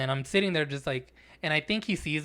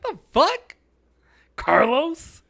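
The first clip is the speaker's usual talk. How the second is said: very high, loud questioning squawk; indignant disbelief; 12 semitones higher, 10 dB louder, much slower than usual. Retold or imitated. Customer confronting the clerk by name, mock-incredulous. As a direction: retell it shooting up high in outraged disbelief. Acted outrage at being bitched out.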